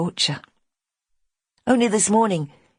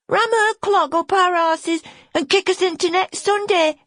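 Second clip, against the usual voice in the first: put-on high child voice, a bit louder; a little lad repeating the threat; small boy, cheeky; 4 dB louder than usual. Small boy quoting Betty.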